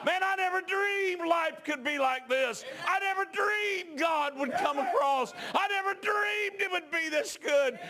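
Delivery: high, loud revival shouting; full-force preaching. Acted. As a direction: preach it to the rafters, stirring the church.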